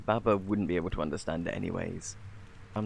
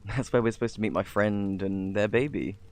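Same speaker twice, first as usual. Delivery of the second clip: ordinary delivery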